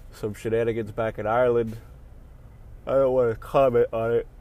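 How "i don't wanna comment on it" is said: spoken through a yawn. Real, not acted. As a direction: talk while yawning. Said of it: "Fuck, I can't stop yawning".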